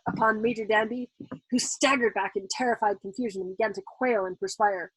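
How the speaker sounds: ordinary delivery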